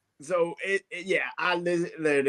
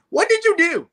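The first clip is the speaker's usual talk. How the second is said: much higher, louder incredulous question; exasperated disbelief; 13 semitones higher, 6 dB louder than usual. Real, not acted. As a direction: demand an answer in disbelief. Exasperated rhetorical question to team.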